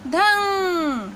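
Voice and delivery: धंग stretched very slowly, nasal ng held; slow teaching demo. Shown as a demonstration. Teacher showing students how to say धंग, very slow. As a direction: draw out धंग for learners. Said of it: "उच्चारण हो जाएगा".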